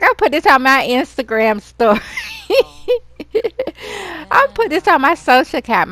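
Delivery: high, loud teasing with a laugh; giggly gossip. Pretend. Friend teasing she will post the burnt kitchen online, high and laughing. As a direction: tease her friend about posting it. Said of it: "She's like".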